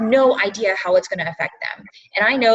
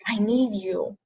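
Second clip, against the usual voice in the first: emotional plea; 4 dB quieter, slower than usual. Real, not acted. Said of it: "I'm gonna get all emotional".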